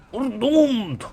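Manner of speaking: put-on very high Mexican-accented dwarf wail; tiny dwarf despairing in a Mexican accent; doomed Mexican dwarf. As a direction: cry doom in a silly Mexican accent. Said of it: "I still sounded Mexican".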